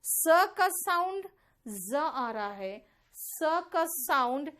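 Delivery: slow स as hissing s, ज as buzzing z; emphatic, slow teaching of स turning into a z ज; sound contrast demonstrated. Shown as a demonstration. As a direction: stretch स and ज apart. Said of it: "अब देखो यहाँ पर ध्यान से".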